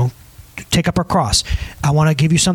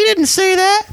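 mocking high voice; 14 semitones higher, slower than usual. Pastor mocks the doubter to the congregation, high squeaky voice.

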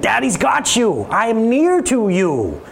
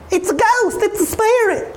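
frightened disciples; 14 semitones higher than usual. Retold or imitated. Frightened disciples see Jesus.

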